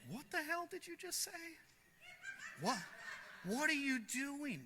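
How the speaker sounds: high-pitched, stunned what-the-hell; shocked outburst. Retold or imitated. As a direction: squeal it out in mock disbelief, slowing on the last part. Guy who knows him reacts.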